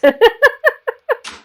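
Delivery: loud, high-pitched laughing fit. Real, not acted. Laughs at her own cat joke.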